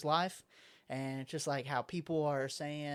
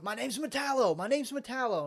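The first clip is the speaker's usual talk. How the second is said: insistent villain shout; 7 semitones higher, 5 dB louder than usual. Retold or imitated. Villain insisting on his name.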